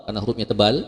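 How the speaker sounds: ordinary talk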